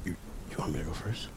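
nervous unvoiced whisper. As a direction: whisper it, scared to go first.

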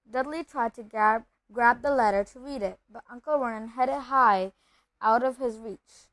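ordinary delivery, nothing marked

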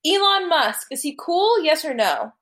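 high, carrying call. Real, not acted. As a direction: project the question to someone far off. Asking someone out of earshot.

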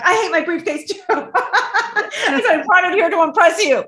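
laughing. Real, not acted. Coach joking with Bella about her briefcase, laughing. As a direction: let the laugh spill into the line.

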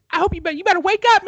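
high, fast, shouted wake-up; hype voice take. Pretend. Host to co-host, wild hype wake-up call. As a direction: urge him awake in a crazed pitch. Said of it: "That was kind of a weird voice".

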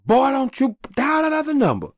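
high, loud put-on voice of a stern father; father forbidding his son to call the ambulance, stern and high; stern old father. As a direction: warn the boy off the phone like an angry dad.

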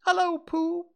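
put-on very high squeaky baby kangaroo; squeaky little Roo. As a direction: squeak it out like an excited little joey. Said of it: "he squeaked".

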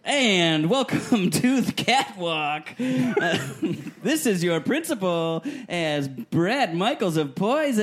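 announcer voice. Acted. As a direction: hype up the catwalk. PA announcer at catwalk.

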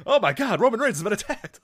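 high, loud laughing play-by-play; excited announcer. Pretend. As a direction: call it like a ringside commentator. Mock announcer call.

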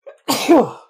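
loud, high real sneeze; sneezing. Real, not acted. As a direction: let a big sneeze burst out. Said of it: "I'm gonna sneeze".

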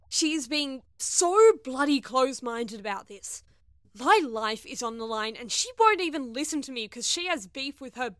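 loud, indignant venting; fuming rant. Acted. Crewmate vents alone about her stubborn friend, fuming.

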